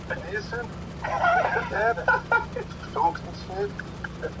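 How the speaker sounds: mumbled Norwegian-sounding nonsense; mock Norwegian. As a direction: imitate Norwegian as half-heard English, drawn out. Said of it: "English when you're asleep".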